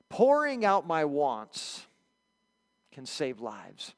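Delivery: slow and weighty, each word placed; slow solemn statement. Shown as a demonstration. Pastor slowly announcing the first lesson to the church. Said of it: "Here's the first lesson".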